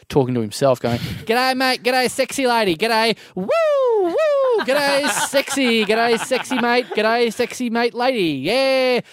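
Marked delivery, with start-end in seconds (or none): 1.3-3.2 put-on high, chattering Australian-accent parody; crazy parrot hawker. Hawker talking to himself up the beach, crazy high parroting. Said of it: "like, this crazy parrot". 3.3-9.0 high, slow whooping greetings; crazy parrot hawker. Retold or imitated. Hawker talking to himself up the beach, whooping crazy parrot voice. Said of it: "like, this crazy parrot".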